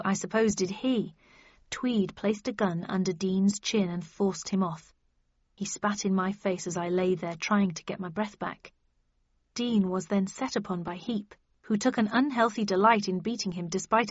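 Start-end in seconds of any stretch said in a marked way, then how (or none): none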